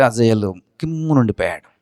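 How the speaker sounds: usual speaking voice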